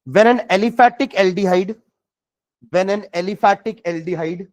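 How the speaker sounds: slow, word by word dictation; dictating slowly. Shown as a demonstration. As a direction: read it out slowly for students to write. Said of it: "next time लिखेंगे".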